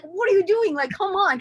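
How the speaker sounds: husband urging her to hurry, impatient. Retold or imitated. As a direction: voice him as exasperated. Husband impatient in parking lot.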